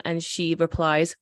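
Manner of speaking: normal talking voice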